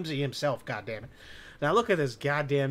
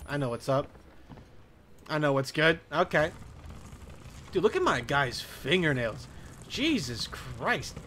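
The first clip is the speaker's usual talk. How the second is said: ordinary delivery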